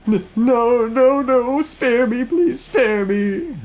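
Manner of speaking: very high, wailing stammered plea; terrified begging. Acted. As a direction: shriek for mercy, dragging out the last words. Bishop begs before being burned.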